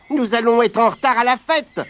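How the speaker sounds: high, quick rabbit voice urging his friend on; hurried rabbit. Acted. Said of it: "disait compère Lapin".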